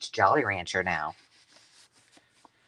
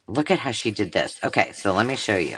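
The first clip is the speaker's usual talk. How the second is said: ordinary talk, nothing marked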